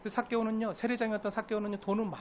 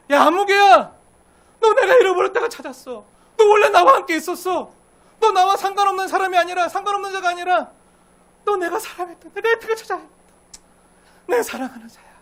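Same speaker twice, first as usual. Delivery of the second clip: much higher, loud joyful cry; joyful calling out; 11 semitones higher, 14 dB louder than usual. Acted. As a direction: shout for joy at finding the lost one. God calling the lost joyfully.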